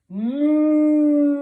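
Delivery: big, loud, drawn-out moo; expressive cow moo. Shown as a demonstration. Teacher leading children in animal sounds, expressive cow moo. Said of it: "say this with loads of expression".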